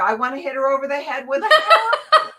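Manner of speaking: joking frustration with a laugh; laughing confession. Retold or imitated. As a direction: blurt the exasperated truth through a laugh. Retold exasperated confession.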